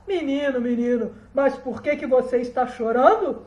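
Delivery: put-on loud hail to a crying child; hint of a storybook donkey; mysterious voice calling. A narrator's reading. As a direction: call out a concerned question. Mysterious voice addresses crying boy.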